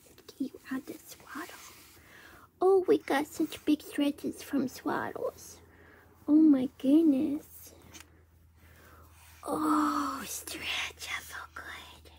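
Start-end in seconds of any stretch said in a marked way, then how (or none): 0.0-5.6 breathy hush, like soothing a newborn; whispering. Real, not acted. Whispering to the baby. 9.4-12.1 breathy hush, like soothing a newborn; whispering. Real, not acted. Baby stretching, parent whispers.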